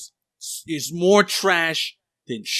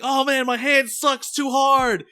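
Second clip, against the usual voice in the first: higher, slower mock whine; voicing Miroku; 8 semitones higher than usual. Miroku complaining about his cursed hand, mock whine. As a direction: whine it like a struggling monk. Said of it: "Moroku's like".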